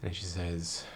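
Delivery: ordinary talk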